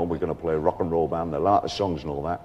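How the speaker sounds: lightly voiced Hull bandmate; a hint of a Yorkshire lad; Hull accent. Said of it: "these guys all came from Hull".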